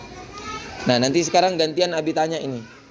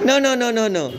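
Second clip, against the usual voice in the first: high, rapid string of refusals; playful firm objection; mild correction; 6 semitones higher than usual. Retold or imitated. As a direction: imitate stopping a small child. Acts out stopping a child.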